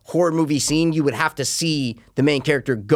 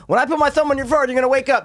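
mock doctor; 11 semitones higher, much faster than usual. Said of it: "she goes".

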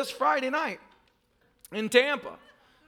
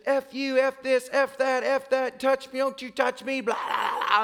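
imitated cussing rant; 4 semitones higher than usual. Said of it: "I have never heard a person cuss".

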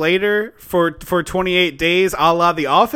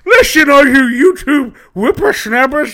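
put-on high crotchety old-timer voice; old prospector; 6 semitones higher, 5 dB louder than usual. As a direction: scold the whippersnappers like a grizzled geezer. Grumpy old-timer scolding youngsters.

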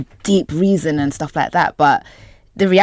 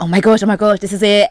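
higher, mildly excited self-quote; retold excitement; 5 semitones higher, 4 dB louder, faster than usual. Actor recalling her call-back news, retold excitement. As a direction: replay a burst of audition hope. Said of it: "I'll be super excited".

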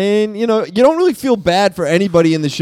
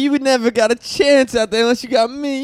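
higher, smarmy croon; lightly voiced sleazy boyfriend; a hint of a greasy lover's croon; 6 semitones higher than usual. Role play as Jimmy.

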